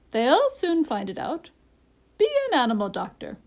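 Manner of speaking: high, emphatic bird voice; bossy parrot with high, swooping pitch; parrot, urging. A narrator's reading. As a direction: push the doctor insistently.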